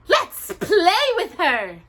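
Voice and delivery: high, slow playful witch trill. A narrator's reading. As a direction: trill it gleefully. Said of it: "trilled the blonde".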